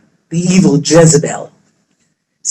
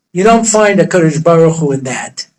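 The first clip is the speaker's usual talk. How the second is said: ordinary talk, nothing marked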